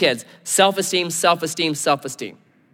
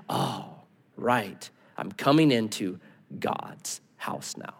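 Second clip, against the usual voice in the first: low, soft, fading into a whisper; humbled realization, trailing into whisper; hushed awe; 8 semitones lower, 10 dB quieter, slower than usual. Acted. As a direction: drop to a reverent near-whisper. Acted hushed awe entering temple.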